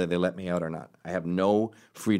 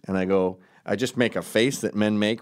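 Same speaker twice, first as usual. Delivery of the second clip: normal talking voice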